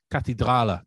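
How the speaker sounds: Kathedrale said the German way; German Kathedrale, throaty r; German pronunciation. Shown as a demonstration. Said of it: "in German".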